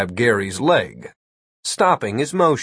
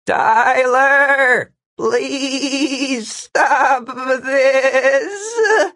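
high, drawn-out bleating wail; weak warbling plea; 14 semitones higher, 3 dB louder, much slower than usual. A narrator's reading. Gary begging Tyler from the distance, warbling wail. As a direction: stretch each word into a high, wobbling cry. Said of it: "a weak, warbling voice said".

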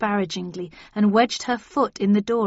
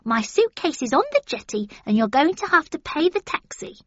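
put-on old lady voice; 7 semitones higher, faster than usual. A narrator's reading. Gran giving orders.

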